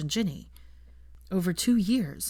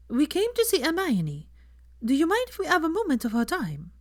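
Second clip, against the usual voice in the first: Fleur; 10 semitones higher than usual. A narrator's reading. Fleur asks Draco for time with Hermione, polite and higher.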